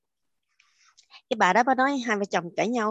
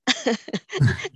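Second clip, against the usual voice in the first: short laugh; 3 semitones higher than usual. Real, not acted. Laughing at own retelling.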